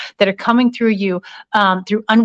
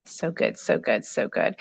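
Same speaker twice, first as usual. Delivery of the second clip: hushed murmur; 4 semitones lower, 10 dB quieter than usual. Real, not acted. Murmurs to herself while reading.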